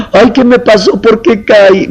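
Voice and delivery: a touch of hammed-up woe; whiny lament. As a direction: play the self-pitying believer who thinks he failed. Believer laments falling into anger.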